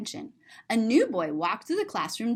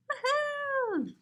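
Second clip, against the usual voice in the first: cheery woohoo; 4 dB quieter than usual. Pretend. Children dancing and singing in picture.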